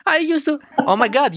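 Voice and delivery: can't believe the news; sudden "oh my God", way up in pitch; shocked. Real, not acted.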